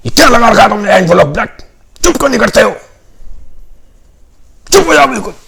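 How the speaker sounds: high, loud furious rebuke; angry outburst. Acted. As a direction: grab him and shout him down. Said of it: "उसको गुस्सा आ जाता है". (गुस्से में ऊँची आवाज़ में डाँटना)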